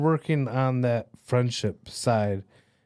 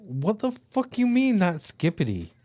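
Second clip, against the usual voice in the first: outraged disbelief; 8 semitones higher than usual. Retold or imitated. Dad indignant at daughter.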